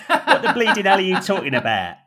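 a market-stall Cockney cracking up mid-line; Cockney barrow boy. Acted. Pooper scooper butting in, laughing Cockney lilt. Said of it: "with his gentle Cockney barrow boy lilt".

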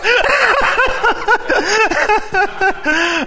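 high, loud laugh; hearty laugh. Real, not acted. Preacher laughing, remembering his old pastor. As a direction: laugh with fond delight.

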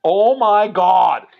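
slow, loud drawn-out oh my God; relieved gasp. Retold or imitated. Father watching daughter land a scary line, slow loud relief. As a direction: let the relief spill out slowly.

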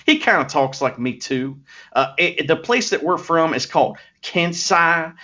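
put-on Southern accent, lightly done; a hint of a put-on American South accent; Master Wato Southern accent. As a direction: play a folksy Southern good-guy wrestler. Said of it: "he's putting on a voice".

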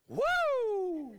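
loud, very high joyful woo; whoop. Retold or imitated. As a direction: whoop like the money just hit. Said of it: "And he goes".

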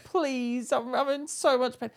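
high mock whimper, begging; whiny high plea. Charlotte begging the nurse for pain relief, high and whiny. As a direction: play it up like a patient in agony. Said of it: "Charlotte was like".

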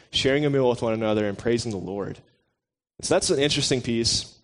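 normal talking voice

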